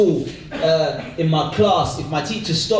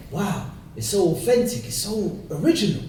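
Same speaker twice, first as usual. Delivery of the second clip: slightly soft, gushing woman; impressed casting director; 4 dB quieter than usual. Acted. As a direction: marvel at a child's audition.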